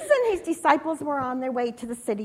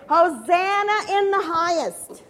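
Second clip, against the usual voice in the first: raised, higher cheer to be echoed; rallying proclamation; 6 semitones higher, 5 dB louder than usual. Acted. Leader cheering Jesus with palm-waving children, rallying. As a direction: call it out for the crowd to repeat.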